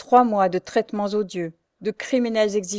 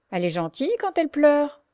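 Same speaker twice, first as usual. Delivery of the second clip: sneering snicker; 8 semitones higher than usual. Acted. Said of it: "il ricana à mi-voix".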